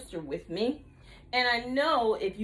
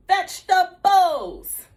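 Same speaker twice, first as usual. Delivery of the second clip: slow loud call; 5 semitones higher, 8 dB louder, slower than usual. Shown as a demonstration. Teacher leading kids to repeat, slow and loud. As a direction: stretch the word out slowly and loudly. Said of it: "One more time".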